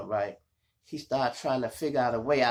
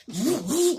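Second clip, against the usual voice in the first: high siren whoops; police siren; 11 semitones higher, 4 dB louder than usual. Retold or imitated. Imitating the cop car behind him, high whoops. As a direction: mimic the siren again.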